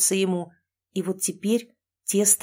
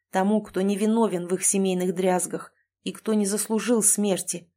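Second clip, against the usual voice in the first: ordinary delivery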